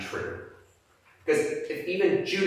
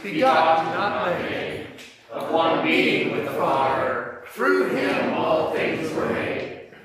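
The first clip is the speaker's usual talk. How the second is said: higher, steady communal recitation; creed recited in unison; 5 semitones higher than usual. Real, not acted. Congregation standing, reciting the Nicene Creed in unison. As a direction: speak the creed together, measured and even.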